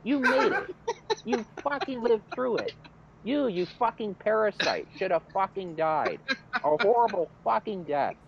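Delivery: high-pitched, indignant tirade; retold angry rant. Driver losing it at a cop, angry.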